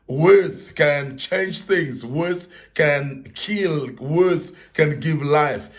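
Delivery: each word slowly weighted; slow emphatic words. Real, not acted. Preacher stresses power of words.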